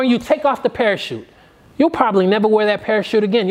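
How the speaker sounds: ordinary delivery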